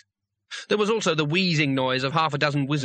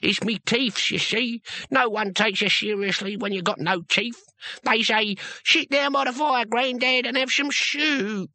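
put-on grumbling old barbarian; 9 semitones higher than usual. Old barbarian complaining to the girl and the wizard, grumbling. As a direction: grumble about age like a toothless old hero. Cohen mocks how people treat him.

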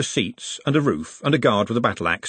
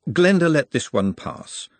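ordinary delivery

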